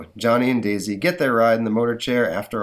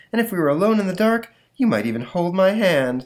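put-on higher, sweet girlfriend voice; Daisy voice; 9 semitones higher, 5 dB louder than usual. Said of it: "says Daisy".

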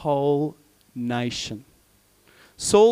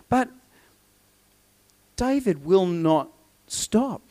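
ordinary talk

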